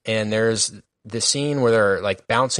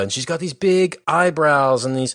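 ordinary talk

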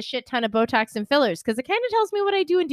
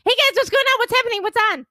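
much higher, manic and fast; hyper coked-up; 13 semitones higher, 3 dB louder, much faster than usual. Pretend. Back from the bathroom to friends, hyper coked-up. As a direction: bounce in way too wired. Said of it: "like the squirrel in Over the Hedge".

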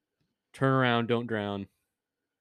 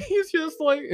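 higher, breaking into laughter mid-phrase; laughing while talking; 3 dB louder than usual. Real, not acted. Friend joking about the unanswered call, laughing. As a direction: crack up while saying it.

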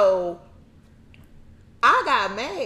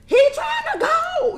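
high, loud, drawn-out disbelief; laughing, slow squeal of outrage; mock incredulous outburst; 6 dB louder, slower than usual. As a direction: exclaim it way up high, playfully. Acting out her reaction to fight.

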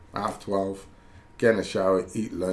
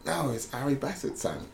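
higher-pitched mock-aggressive Cockney; Harry Bassett; 6 semitones higher, 5 dB quieter than usual. Manager insisting to a doubting player it's really him, mock-aggressive Cockney. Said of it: "Trying to get dead aggressive with me".

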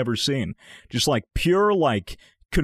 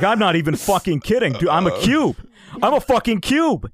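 mock panic, rising higher and louder; urgent insistence; 5 semitones higher, 3 dB louder than usual. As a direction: insist on it like a tripping man in earnest. Roommate insists he is a cube.

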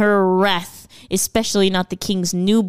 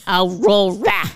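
Australian accent; slower than usual. Shown as a demonstration. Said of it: "in an Australian accent".

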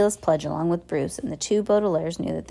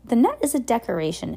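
falsely smiling; 5 semitones higher than usual. A narrator's reading. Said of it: "with some false, with a smile".

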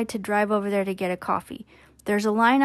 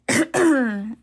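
sharp throat clearing; coughing fit; 4 semitones higher, 5 dB louder than usual. Real, not acted. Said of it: "Sorry, I keep coughing".

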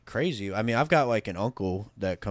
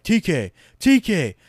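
slow, drawn-out call, louder and much higher; 10 semitones higher, 8 dB louder, slower than usual. Retold or imitated. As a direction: call his name across the staging area. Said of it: "sometimes I'm like".

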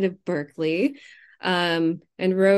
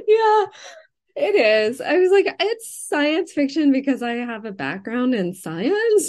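laughing; 7 semitones higher, 5 dB louder than usual. Real, not acted. Laughing at herself.

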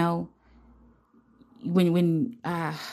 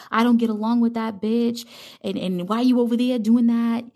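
pitched-up gossip voice; overheard woman; 4 semitones higher than usual. Retold or imitated. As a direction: imitate a worked-up young woman.

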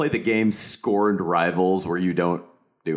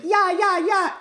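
high voiced yeah yeah; high breathy character voice; 3 dB louder, slower than usual. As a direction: squeal it up high.

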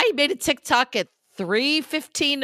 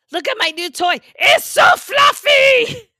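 gushing about the toy; 12 semitones higher, 7 dB louder than usual. Retold or imitated. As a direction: squeal over something fluffy. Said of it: "going around to people going".

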